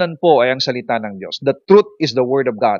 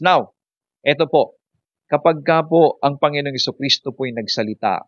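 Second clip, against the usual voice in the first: normal talking voice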